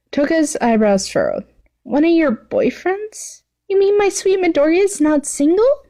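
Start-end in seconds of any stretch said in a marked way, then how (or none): 1.8-5.8 put-on high, sing-song girly voice; a sweet, high obsessive schoolgirl; gushing villain girl. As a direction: play the clingy yandere girl, all sugar. Toga voiced in read story.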